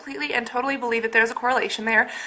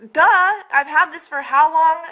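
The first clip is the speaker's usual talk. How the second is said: a slower, louder, higher retort; sarcastic duh; 5 semitones higher, 4 dB louder than usual. Retold or imitated. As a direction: roll your eyes at her mom. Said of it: "I was like".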